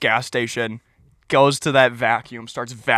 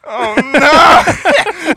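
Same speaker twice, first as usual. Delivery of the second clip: high, loud mock groan; hammed-up oh no; mock dismay; 12 semitones higher, 9 dB louder than usual. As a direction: stranger finds cheese in the vacuum. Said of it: "he's like".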